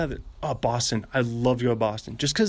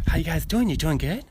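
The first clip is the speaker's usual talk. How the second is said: higher, whispery Boston voice; light whisper voice with questioning lifts; Mark Wahlberg; 6 semitones higher than usual. Acted. Copying a comedian's celebrity impression, whispery Boston accent. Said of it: "impression of Daniel Van Kirk doing Mark Wahlberg".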